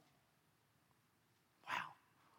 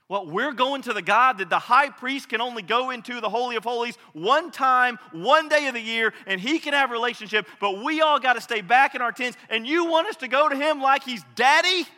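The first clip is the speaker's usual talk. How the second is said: higher and louder, incredulous protest; shocked disbelief; 6 semitones higher, 8 dB louder than usual. Retold or imitated. Disciples stunned that Jesus says come to God as daddy, higher and louder in disbelief. I think they're saying, "Wait, what?.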